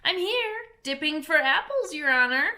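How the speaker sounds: high, eager servant voice; new servant voice. Acted. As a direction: answer the master cheerfully from the garden. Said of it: "a voice that she had never heard before".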